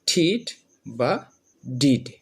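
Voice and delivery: ঠিট বা ডিড said very slowly, each ending a short "id"; new ed sound. Shown as a demonstration. Teacher showing students the new ending, very slow. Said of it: "উচ্চারণ হবে ইড".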